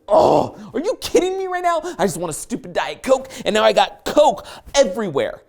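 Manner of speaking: high exasperated cry, then emphatic complaint; frustrated outburst. Retold or imitated. Driver soaked in Coke at the drive-thru, retold frustrated outburst. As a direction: vent in hammed-up exasperation. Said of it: "I was so frustrated".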